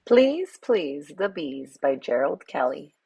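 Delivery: plain reading voice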